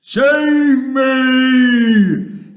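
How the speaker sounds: high, drawn-out giant scream. A narrator's reading. Giant in nightmare pleading, screaming. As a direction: scream for rescue at full volume. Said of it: "screamed the flesh lump eater".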